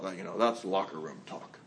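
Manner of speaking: an excuse-making man shrugging it off; mock macho. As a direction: drop low like a dismissive guy. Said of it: "many of us excuse this".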